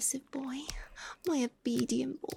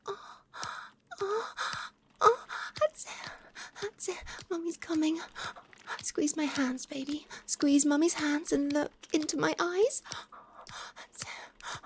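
climax moans; 5 semitones higher than usual. Acted. Partner moaning through her climax with her lover. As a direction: let the moans peak then ease off.

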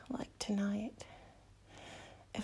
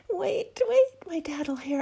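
startled; 5 semitones higher, 8 dB louder than usual. Acted. Sudden alarm mid scene.

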